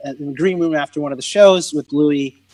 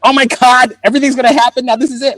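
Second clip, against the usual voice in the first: higher and louder, giddy; excited; 7 semitones higher, 4 dB louder than usual. Retold or imitated. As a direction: inner voice bursting with hope. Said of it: "But inside, I'm like".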